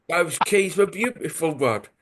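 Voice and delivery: put-on overdone London crook accent, relishing it; London accent, mock threatening. Chap reports on the burglary to Rod, in a comic London accent. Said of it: "He just wouldn't sound as threatening".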